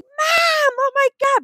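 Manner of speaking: very high-pitched mock scream for help; squealed, hammed-up alarm; mock panicked child. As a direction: imitate a kid fleeing in horror. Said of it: "he probably would have run being like".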